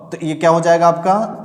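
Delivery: ordinary talk, nothing marked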